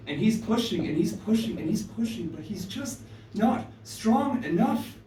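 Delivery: rising, urgent, pushing harder each time; straining narration. A narrator's reading. Narrator telling Crow's struggle in the storm, straining and urgent. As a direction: build the strain as Crow fights the wind. Crow straining against wind.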